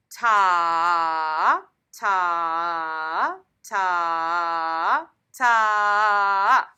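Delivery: loud, slow ta held for three beats each; long held beats. Shown as a demonstration. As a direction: stretch every ta across the whole note. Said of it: "they're all dotted half notes".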